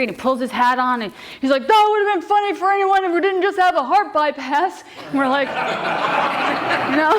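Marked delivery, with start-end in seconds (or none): 1.7-4.8 high-pitched, indignant rant; angry old man. Retold or imitated. Old man scolding the kids after the scare, angry high voice. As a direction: scold in a put-on outraged voice. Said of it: "he's all angry".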